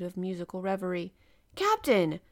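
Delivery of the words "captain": a loud high call to get his attention; exclaiming. A narrator's reading. Professor calls to the absorbed captain, loud exclaiming. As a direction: call out sharply across the room. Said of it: "I exclaimed".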